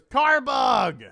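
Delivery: loud, high call-out; announced shout. Retold or imitated. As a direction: belt out the punchline. Calling out Belushi's joke.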